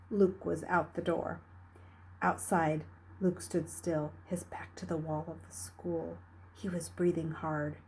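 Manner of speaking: ordinary delivery, nothing marked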